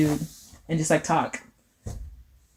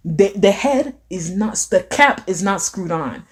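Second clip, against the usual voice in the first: normal talking voice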